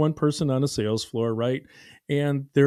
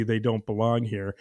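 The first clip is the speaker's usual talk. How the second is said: ordinary delivery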